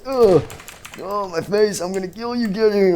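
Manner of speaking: hurt and furious, go after them; pained threat. Acted. A monster shot in the face, vowing revenge in pain.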